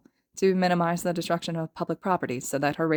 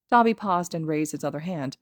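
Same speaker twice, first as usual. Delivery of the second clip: plain reading voice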